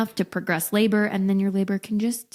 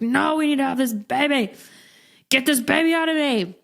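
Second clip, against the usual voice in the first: higher, pleading mock insistence; desperate insistence; 5 semitones higher than usual. Pregnant wife insisting to husband, higher desperate plea. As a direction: beg like you can't wait another day.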